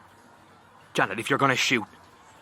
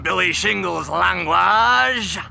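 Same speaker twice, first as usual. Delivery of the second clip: character voice; 7 semitones higher, 4 dB louder, slower than usual. Acted. Character voice in comedy play.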